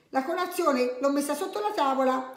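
high-pitched mild call to the family. A narrator's reading. Story mother calls children to breakfast, calling out. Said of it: "chiamò la mamma".

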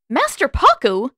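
high, loud cry of recognition; exclaiming. A narrator's reading. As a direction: call his name out in shock. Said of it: "Katara exclaimed".

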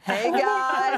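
high, loud hello to a group; excited greeting. Real, not acted. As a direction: call out a delighted hello to fans. Greeting the surprise fan wall.

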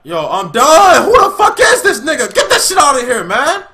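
yelling high through laughter; cracking up while yelling; laughing shout. Real, not acted. Laughing yell at goal.

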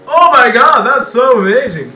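high, loud fake delight; mock excitement. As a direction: squeal it like overjoyed fan. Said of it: "I'm like".

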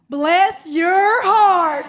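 very slow, loud and emphatic; drawn-out shout. Shown as a demonstration. Southern woman shows the crowd hateful words, slow shout. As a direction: belt it out, stretching each word.

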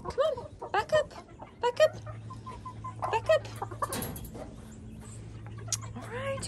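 high, repeated coaxing to the hens; high coaxing call. Real, not acted. Shooing her hens back from the door, high coaxing call.

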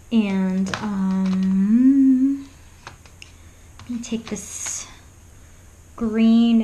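ordinary talk, nothing marked

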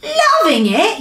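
high, loud incredulous yell; outraged yell. A narrator's reading. Disgusted giant answers the BFG, yelling. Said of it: "yelled the blood bottler".

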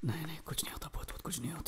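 barely audible self-reassurance; tiny, hushed muttering in the dark; frightened whisper. Acted. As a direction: whisper it to calm your own fear. Reassuring himself in fear.

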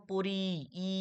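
পরি with the final "i" held out, then ই alone; short-i sound shown. Shown as a demonstration. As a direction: stretch the "i" of পরি and repeat it as ই. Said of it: "হর্ষাইয়ের মতো উচ্চারণ হচ্ছে না". (পরি-র শেষে ই ধ্বনি টেনে)

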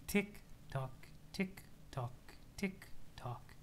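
soft, voiceless clock ticks; whispered ticking. Acted. Bored character waiting for the apocalypse, whispered ticking.

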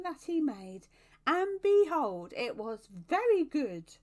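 loud, high-pitched cherub proclamation; calling out. A narrator's reading. Cherub praising the beauty of the landscape, calling out. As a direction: proclaim it high and loud, stretching the big words. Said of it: "he called out".

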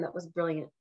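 ordinary talk

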